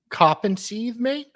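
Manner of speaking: badly faked Aussie, high and rising; high-pitched stab at Australian; Australian accent. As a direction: try an Australian accent, lifting sharply on "mate". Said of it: "That's not an Australian accent".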